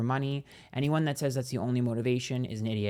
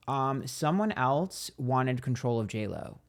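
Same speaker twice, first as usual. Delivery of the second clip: usual speaking voice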